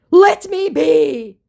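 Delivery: high, loud, slow hammed-up lament; mock the scammer's self-pity; overdone wail of woe. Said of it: "he laments".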